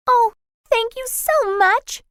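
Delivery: high, light little fairy voice; tiny silvery fairy voice. A narrator's reading. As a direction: call out sweet and tiny like a fairy. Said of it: "she called in a tiny silvery voice".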